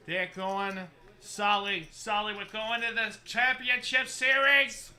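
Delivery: put-on loud, high Boston play-by-play call with a slow, stretched Boston accent. Commentator calls the Red Sox winning run, loud Boston accent. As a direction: belt out the home run like a Boston radio announcer. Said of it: "Sorry, that's my Boston accent".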